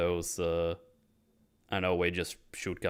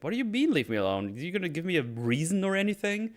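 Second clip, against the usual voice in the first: high disbelieving echo of leave me alone; retold outraged disbelief at the lawyer; incredulous echo; 11 semitones higher than usual. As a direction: throw the words back in disbelief. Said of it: "It's like".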